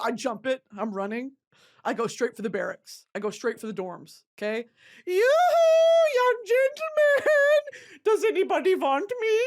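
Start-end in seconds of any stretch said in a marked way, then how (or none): 5.1-9.4 put-on high, slow, loud German-accented cry; mock German girl call. Pretend German girl calling soldiers.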